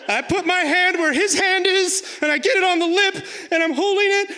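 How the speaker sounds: put-on high, strained kid in terror; mock-terrified high voice; panicky squeal. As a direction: squeal it out, barely holding on. Reenacts terrified kid holding huge fish.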